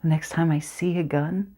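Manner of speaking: soft, tense recital; dramatic monologue narration. Acted. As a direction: draw the listener into the dark orchard.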